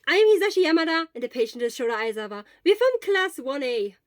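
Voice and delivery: fast, loud, high eager introduction. Acted. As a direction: rattle it off full of eagerness. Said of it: "with the same eagerness".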